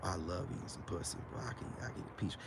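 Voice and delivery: very low, hushed bedroom murmur. Acted. Man sweet-talking a woman with dirty talk, very low and hushed. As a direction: drop deep and soft, like a smooth seducer.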